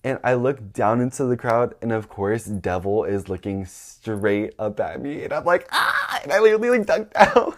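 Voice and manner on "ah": very high startled shriek; acted-out scream of alarm; panicked yelp. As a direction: yelp as if caught in the act. Acting panic at being seen.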